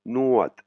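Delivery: Nuat starting high and falling; falling tone demo. Shown as a demonstration. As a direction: start Nuat up high, then let it fall. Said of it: "intonation cassée qui commence par les graves". (Nuat avec un ton descendant, départ aigu)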